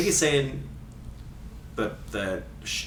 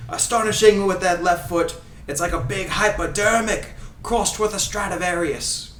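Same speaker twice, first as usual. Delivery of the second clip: higher, theatrical fake Scottish accent; Ray Hudson Scottish accent; 4 semitones higher than usual. Host reading commentary quotes for guessing, in fake Scottish accent. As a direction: read it as a grand Scottish football commentator. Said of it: "doing his accent".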